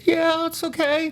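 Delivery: high, drawn-out, acted agreement; tentative consent. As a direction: stretch it out slowly in a high voice. Acting out his own bemused consent.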